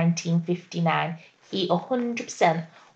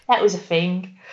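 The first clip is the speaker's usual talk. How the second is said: normal talking voice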